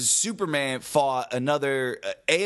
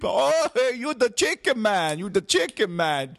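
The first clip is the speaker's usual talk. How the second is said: movie quote; 10 semitones higher, 3 dB louder than usual. Retold or imitated. Quoting The Room scene.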